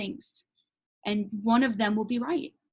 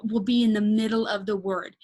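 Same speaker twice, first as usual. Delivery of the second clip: normal talking voice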